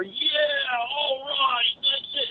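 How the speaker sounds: high whooping cheer of loaders; cheering crowd imitation. Truckers watching a speech on TV, imitated cheering. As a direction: cheer like the guys in the break room.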